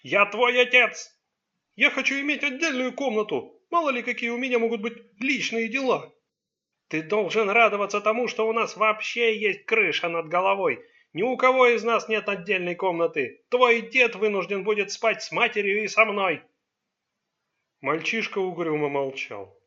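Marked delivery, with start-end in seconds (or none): 0.0-1.2 loud, high, drawn-out assertion; exploding. A narrator's reading. Father asserts his authority over his son, exploding. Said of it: "взорвался Чанг". 1.8-6.1 demanding. A narrator's reading. Son demands his own room. 6.9-16.4 loud, raised-pitch lecture; scolding. A narrator's reading. Father scolds son who wants a room of his own, angry.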